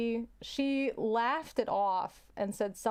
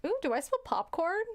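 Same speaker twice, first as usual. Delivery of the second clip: fake innocent; 7 semitones higher than usual. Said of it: "if you fart, tell somebody".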